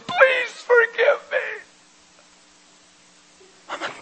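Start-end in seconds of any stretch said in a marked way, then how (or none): 0.1-1.4 slow, much higher sobbing plea; crying plea. Retold or imitated. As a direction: beg for forgiveness through tears. Wife begs forgiveness crying.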